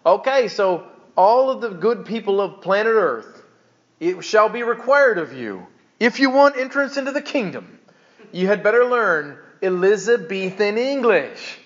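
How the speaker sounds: grandly declaimed ruling, played for ridicule; mock solemn decree. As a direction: lay down the law like a pompous herald. Mock decree ridiculing King James insistence.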